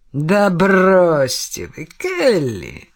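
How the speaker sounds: slow, knowing push-back; slow objection. Acted. Detective dismissing Kelly's denial, slow and knowing. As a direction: drag it out, unimpressed. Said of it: "возразил Темплтон".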